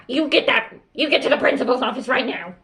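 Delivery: fast, agitated outburst; hurried mock tirade at a pupil; yelling teacher demo. Shown as a demonstration. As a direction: rattle off the order in a fluster. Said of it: "Don't be like".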